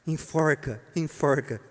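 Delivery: ordinary talk, nothing marked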